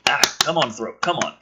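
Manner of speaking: ordinary talk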